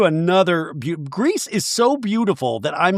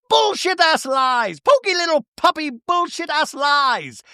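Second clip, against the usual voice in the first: Bronwyn, outraged; 8 semitones higher than usual. Retold or imitated. Bronwyn walks off cursing lies, hammed-up outrage. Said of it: "Bronwyn goes".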